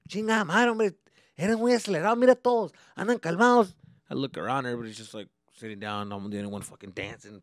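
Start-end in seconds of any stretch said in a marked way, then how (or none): 0.1-3.7 loud, high Spanish scolding; upset dad. Retold or imitated. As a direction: rein in the hyper kid. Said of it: "my dad would just get upset".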